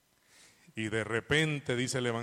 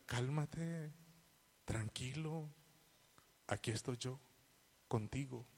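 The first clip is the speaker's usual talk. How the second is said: softer, hushed reassurance; gentle comfort; 9 dB quieter than usual. Acted. Preacher voicing God comforting the faithful, soft and tender. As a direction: speak quietly, as God holding someone. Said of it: "y nos diga".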